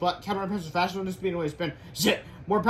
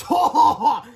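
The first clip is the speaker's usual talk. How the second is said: loud, higher villain ho ho; evil laugh; 10 semitones higher, 10 dB louder, much slower than usual. Acted. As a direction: cackle like a cartoon villain.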